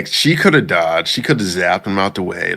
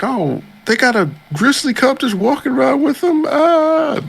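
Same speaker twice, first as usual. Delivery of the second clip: much higher, mock scared bandit voice; 4 dB louder than usual. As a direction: squeak like a nervous bandit spotting the cub. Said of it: "They're like".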